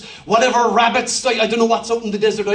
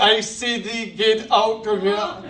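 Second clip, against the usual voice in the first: put-on old King James voice; slower than usual. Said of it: "Nothing's kind of".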